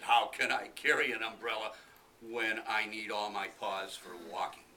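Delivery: loud indignant tiger voice; a tiger scoffing at the idea; grumbling tiger. Acted. Tiger objects.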